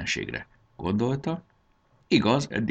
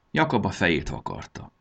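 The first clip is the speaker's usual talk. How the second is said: usual speaking voice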